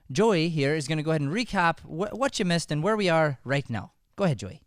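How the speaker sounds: ordinary delivery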